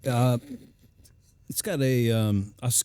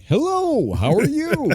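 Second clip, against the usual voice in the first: much higher, sugary greeting; exaggerated polite voice; slower than usual. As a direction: greet them way too sweetly. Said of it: "everyone's like".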